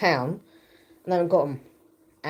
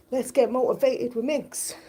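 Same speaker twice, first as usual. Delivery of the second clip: high, drawn-out motivational slogan; pep them up like a fitness host; slow, raised-pitch cheesy catchphrase; mock motivational; 7 semitones higher than usual. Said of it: "why I said it like that".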